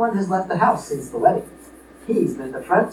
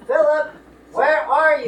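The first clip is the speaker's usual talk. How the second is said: calling out; 7 semitones higher than usual. Acted. Father calling through the house.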